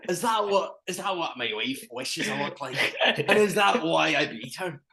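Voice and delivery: high hammy Scottish voice; mock Scots husband musing; Scottish accent.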